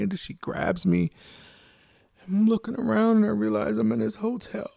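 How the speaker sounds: ordinary talk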